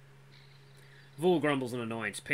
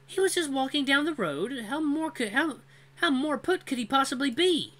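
high, indignant female voice; exasperated young woman; 7 dB louder than usual. Acted. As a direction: protest in exasperation. Cora defends the narrator.

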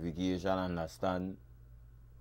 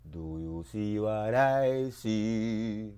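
slow, stretched-out chant; slow drawn refrain; slower than usual. Acted. Poet slowly chants the refrain back at listeners. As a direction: draw every word out.

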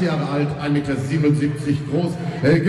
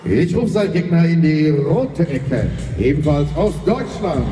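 boxing ring announcer; 4 semitones higher, 3 dB louder than usual. Acted. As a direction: stretch the names like a ring announcer. Ring introduction of fighters. (Ringsprecher, der die Namen langzieht)

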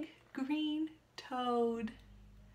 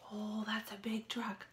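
whisper; 5 semitones lower, 6 dB quieter than usual. Real, not acted. Reader awed by big truck.

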